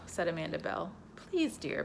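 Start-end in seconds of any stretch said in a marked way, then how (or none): 1.2-1.8 pleading. Acted. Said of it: "begged her mother".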